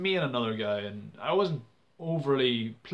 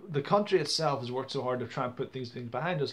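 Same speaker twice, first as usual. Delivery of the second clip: usual speaking voice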